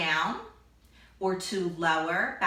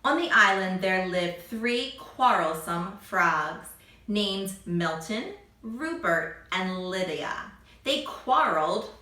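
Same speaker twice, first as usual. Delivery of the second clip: put-on reading to children. Reads story to children.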